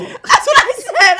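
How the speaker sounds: laughing, high and loud. Real, not acted. Laughing while telling a friend about the child's aim. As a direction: burst out laughing mid-line.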